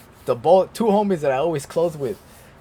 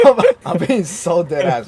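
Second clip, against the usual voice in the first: loud, higher, through laughter; laughing insistence; 5 semitones higher, 9 dB louder than usual. Real, not acted. Telling a coworker he is serious, laughing insistence. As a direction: insist while cracking up.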